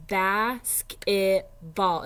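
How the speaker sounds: slow, loud basketball stretched out; syllables drawn out. Shown as a demonstration. Teacher to parents, drawing out the word. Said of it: "so for example".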